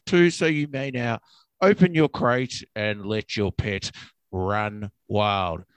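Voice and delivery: low captain PA announcement; over the intercom. Pretend. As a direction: drone like a bored pilot. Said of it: "this is your captain speaking".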